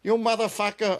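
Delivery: high mock-angry mother; angry mother quote. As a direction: squeal it like an outraged mom. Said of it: "And the mother goes".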